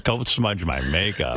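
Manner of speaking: low, slow, drawn-out mock warning; mock macho replay. Host mocks his own vanity, low and slow. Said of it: "Now you've turned into Burt Reynolds".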